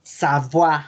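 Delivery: Savoie as sabwa; French pronunciation. Shown as a demonstration. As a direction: model the French oi as wa. (Savoie pronunciado sabua)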